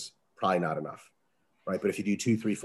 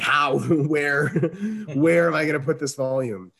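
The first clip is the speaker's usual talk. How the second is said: raised, louder incredulous laughing; laughing disbelief; 6 semitones higher, 7 dB louder than usual. Real, not acted. Coach ranting about more volume, laughing in disbelief. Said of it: "incredulous laughing rant".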